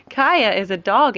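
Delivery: usual speaking voice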